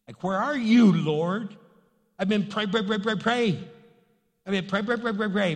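exasperated complaint. Acted. Preacher acts a believer complaining to the Lord, exasperated. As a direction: demand answers in acted exasperation.

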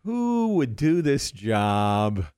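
slow, drawn-out mock musing; mock pondering. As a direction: wonder aloud with slow, playful deliberation. Imagines Fallon choosing someone to hire.

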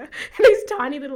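normal talking voice

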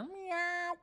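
high-pitched pretend meow; imitated cat meow. Streamer thanking a member named Cat, playful high meow. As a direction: mew it like a cat.

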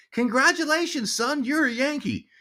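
very high, sustained imitation of mom; proud mom. Mom announcing the deal to son, high proclamation. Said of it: "She goes".

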